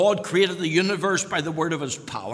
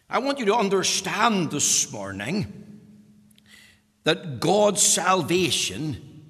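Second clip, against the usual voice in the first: ordinary talk, nothing marked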